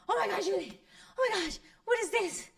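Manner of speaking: imitating mom's euphoria, very high-pitched and loud; ecstatic screaming. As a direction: squeal it out in overjoyed disbelief. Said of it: "she started screaming and jumping".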